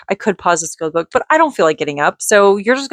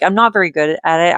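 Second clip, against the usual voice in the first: usual speaking voice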